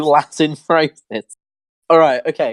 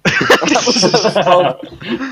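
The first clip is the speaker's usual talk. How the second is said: laughing; much slower than usual. Real, not acted. Laughing while speaking.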